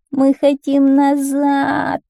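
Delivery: high, drawn-out loud whine; wailing shout. A narrator's reading. Whiners begging the garbage man to go back, wailing. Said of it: "закричали нытики".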